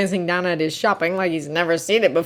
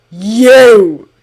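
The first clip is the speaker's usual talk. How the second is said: loud, drawn-out angry growl; threatening growl; 10 dB louder than usual. A narrator's reading. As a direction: growl it out as a menacing accusation. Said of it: "he growls".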